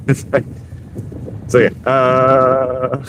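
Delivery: choppy voice like talking into a fan. Shown as a demonstration. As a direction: let a fan chop the sound. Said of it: "talk into a fan".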